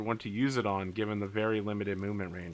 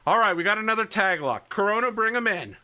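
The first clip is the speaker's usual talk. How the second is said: quick, high, raised voice; urgent radio order; 9 semitones higher, 5 dB louder, faster than usual. Acted. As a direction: call in the team mid-battle. Howler calling orders over the radio.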